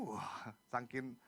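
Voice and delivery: normal talking voice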